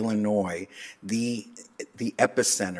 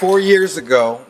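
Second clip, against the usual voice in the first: loud opener; 5 semitones higher, 11 dB louder than usual. Real, not acted. Coach begins loudly after laughing.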